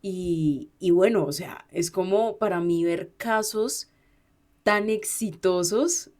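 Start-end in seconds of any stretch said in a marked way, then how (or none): none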